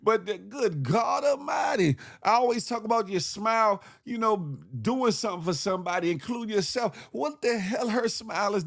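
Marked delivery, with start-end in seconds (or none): none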